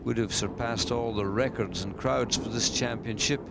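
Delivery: usual speaking voice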